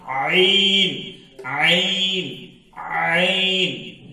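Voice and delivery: "عین" drawn very long from the throat; slow, sustained, throaty "عین"; stretched out. Shown as a demonstration. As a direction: model "عین" slowly, holding the vowel. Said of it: "اس طرح ادا کرنا چاہیے".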